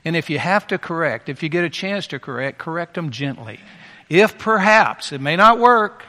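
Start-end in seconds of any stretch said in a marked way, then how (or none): 4.1-6.0 urgent emphasis. Real, not acted. Preacher pleading scripture urgently.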